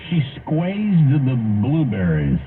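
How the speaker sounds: put-on slow, drawn-out Kiwi accent. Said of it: "Kiwi accent".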